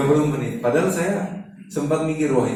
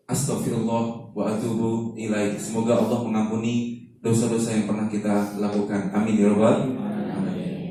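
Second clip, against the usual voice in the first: prayer; 5 semitones lower, 4 dB quieter than usual. Acted. Closing prayer.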